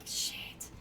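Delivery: breathy whispered shit under the breath; whispered curse. Retold or imitated. To himself, realising he must use his left hand, whispered. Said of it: "So I was like".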